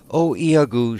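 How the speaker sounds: put-on timid girl. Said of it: "more timid than the rest".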